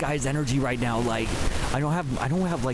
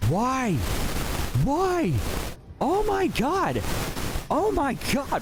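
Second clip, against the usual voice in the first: much higher, drawn-out cries of shock; shocked disbelief; 10 semitones higher, slower than usual. Real, not acted. Watching a grown man's tantrum, shocked high cries. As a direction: cry out in shocked disbelief at the screen.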